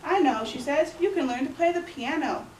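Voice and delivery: ordinary talk, nothing marked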